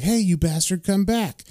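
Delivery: half-hearted yell after someone; mock yell. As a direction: imitate calling after someone without real volume. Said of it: "yells back at him".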